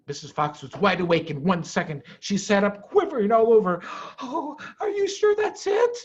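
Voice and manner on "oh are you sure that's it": usual speaking voice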